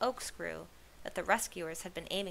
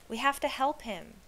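higher, louder plea; urgent cry; 6 semitones higher, 5 dB louder than usual. A narrator's reading. Lizzie pleading to help a friend, urgent cry. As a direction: insist on action now. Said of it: "Lizzie cried".

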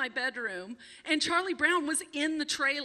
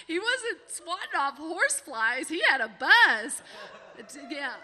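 ordinary delivery

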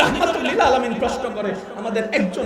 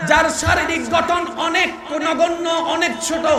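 loud, higher preacher's cry; preaching at full force; 5 semitones higher, 6 dB louder than usual. Acted. Preacher thundering to crowd about the tiny ant. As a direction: build the wonder and shout it out.

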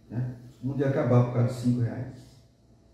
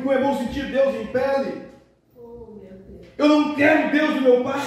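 whiny son; 12 semitones higher than usual. Acted. Son complains to mother.

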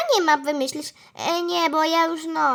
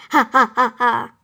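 staged ha-ha-ha; mock laughter; 5 semitones lower, 5 dB louder than usual. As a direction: fake a laugh in separate beats. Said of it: "potem będą się jej śmieli".

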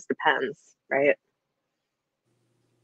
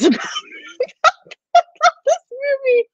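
laughing; much slower than usual. Real, not acted. Recalling scary childhood film, laughing.

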